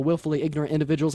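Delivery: usual speaking voice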